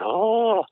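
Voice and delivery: high, drawn-out "oh" of hurt; mock cry of pain. Injured trainee retelling his fall from the bar, mock cry of pain. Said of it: "I was in so much pain".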